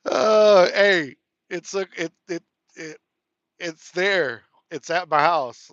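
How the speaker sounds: loud, bursting with gratitude; excited thanks. Real, not acted. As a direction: blurt it out, overjoyed. Excitedly thanks for gift received.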